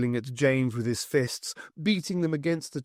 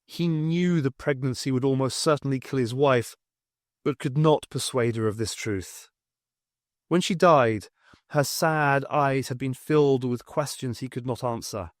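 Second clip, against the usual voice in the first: ordinary delivery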